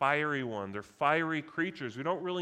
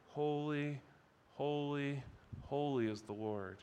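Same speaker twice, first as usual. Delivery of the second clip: softer, hushed echo of the call; softly repeated praise; 6 dB quieter than usual. Acted. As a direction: let the words fall quietly in awe. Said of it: "by calling out these words".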